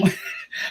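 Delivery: high squealing laugh; burst of laughter. Real, not acted. Host cracks up at comment.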